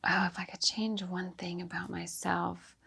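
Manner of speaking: a whisper to herself, mulling it over. Real, not acted. Guest pondering the host's last question, whispering. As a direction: breathe the words out as she thinks. Musing aloud before answering.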